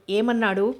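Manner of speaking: plain reading voice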